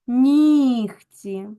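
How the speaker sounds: "нігці" loud, long І, Г as Х; devoiced Г. Shown as a demonstration. Teacher dictates transcription to pupils, devoiced Г. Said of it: "на глухий".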